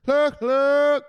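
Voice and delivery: very high, loud squawk; mock BlackRock outburst. Mocking what BlackRock is saying, high loud squawk. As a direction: squawk it loud, far above your voice. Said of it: "BlackRock is saying shit like this".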